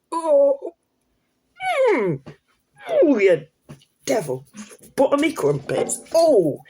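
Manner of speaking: high, playful ooh-ing and cooing; mock swooning. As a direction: pretend to swoon, pitch swooping up and down. Pretend swooning over attractive chef.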